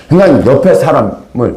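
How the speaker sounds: usual speaking voice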